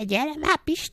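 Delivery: lightly voiced nagging call, high then dropping away; calling wife. Woman on the beach to her man, mock calling.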